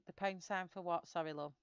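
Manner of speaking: normal talking voice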